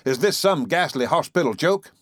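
high-pitched, indignant outburst; thundering rage. A narrator's reading. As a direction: thunder the accusation. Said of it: "thundered Mister Button".

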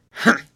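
a loud disdainful snort of a humph; loud scornful humph. A narrator's reading. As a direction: snort it out like a grumpy old uncle. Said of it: "snorted Uncle Isaac".

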